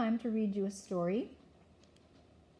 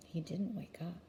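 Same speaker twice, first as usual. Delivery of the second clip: ordinary delivery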